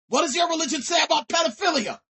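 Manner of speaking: a furious challenge, shouted; angry shout. Real, not acted. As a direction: raise the voice and hurl the question. Furious rant at a religious group.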